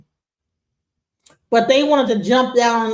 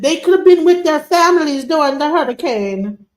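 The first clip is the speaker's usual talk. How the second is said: mocking Caribbean accent, higher and a bit louder; 6 semitones higher, 4 dB louder than usual. Host mocking the complaining woman in a Caribbean accent. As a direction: parody her Caribbean lilt with scorn. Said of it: "Her whole accent is coming out".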